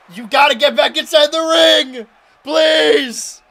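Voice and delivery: loud, high, pleading yell; desperate shout. Real, not acted. Commentator begging his wrestler to get back in, shouting desperately. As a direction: beg him back into the ring at full voice.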